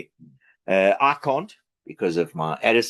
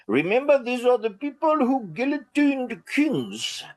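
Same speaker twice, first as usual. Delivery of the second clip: higher, playful fake French accent; mock French accent; 11 semitones higher than usual. Talking about French strikers in a mock French accent. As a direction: ham up a not-very-French French accent. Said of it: "did you like my, uh, French accent?".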